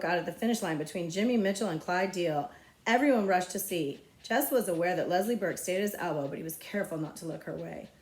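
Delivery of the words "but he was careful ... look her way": usual speaking voice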